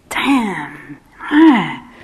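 grunting envy, then a loud ah; sudden loud frustrated ah; frustrated noises. Retold or imitated. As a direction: let out envious exasperation. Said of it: "the words are for those noises".

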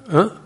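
"Huh" as a short high exclamation. Shown as a demonstration. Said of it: "to us it's an exclamation".

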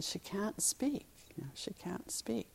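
soft whisper by a sickbed; hushed whisper. Acted. Father whispering to the young man beside his sick daughter. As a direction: whisper so the sick girl isn't disturbed.

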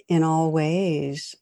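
ordinary talk, nothing marked

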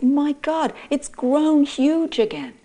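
stunned to see it huge again; shocked exclaim. Acted. Boy turns and sees the monster grown huge, shocked and loud.

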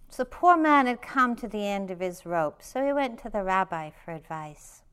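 reading a folk tale aloud to the room; story reading. A narrator's reading. Teacher reads a rabbi story to retreat listeners, storytelling voice.

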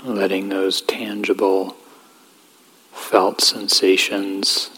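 calm, even voice with long pauses; guided meditation. Real, not acted. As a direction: gently guide them inward, leaving space between phrases.

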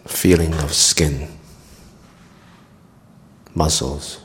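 calm meditation guide with long pauses; guided meditation. Acted. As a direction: guide them gently through the body, leaving long silences.

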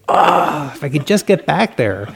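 mock yearning, sighing for the lost calm; longing sigh. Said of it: "Next day".

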